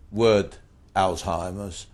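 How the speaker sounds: normal talking voice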